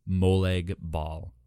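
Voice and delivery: ordinary delivery, nothing marked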